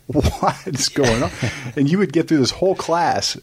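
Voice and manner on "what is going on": bewildered. Retold or imitated. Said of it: "I would be just like".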